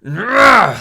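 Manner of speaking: loud high angry cry; angry roar. Acted. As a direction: let out a furious yell. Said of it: "Sagar cried in anger".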